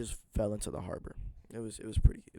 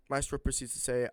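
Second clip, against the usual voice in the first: plain reading voice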